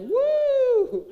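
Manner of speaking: high, loud mock cheer; crowd whoop. Audience answering how's it going, mock whoop. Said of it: "It's like".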